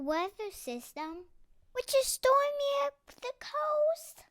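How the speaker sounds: high, fast, lecturing little bird voice; urgent know-it-all bird. A narrator's reading. Calvin urges his family to flee the storm, high fast lecture. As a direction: rattle it off like a tiny expert.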